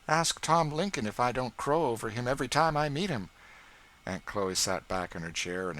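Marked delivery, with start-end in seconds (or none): 0.1-3.3 put-on boastful boy. A narrator's reading.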